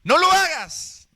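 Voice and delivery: high, loud, slow shout of warning; conscience shouting. Acted. Conscience yelling at a child about to steal, loud shout. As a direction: scream the warning as the inner voice. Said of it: "la conciencia te grita".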